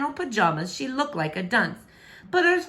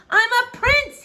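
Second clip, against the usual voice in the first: high, louder cry, fist shaking; 7 dB louder than usual. Acted. Prince crying out his rank to the girl below, loud. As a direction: shout it with indignant pride. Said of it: "he cried out with the shake of his fist".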